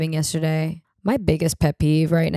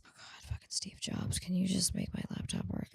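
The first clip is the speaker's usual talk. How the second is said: whispered grumble at the laptop; muttered curse; 17 dB quieter than usual. Real, not acted. Annoyed at her broken laptop, whispered grumble. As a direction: curse the computer under your breath.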